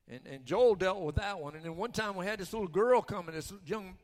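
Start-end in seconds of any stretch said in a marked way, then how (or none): none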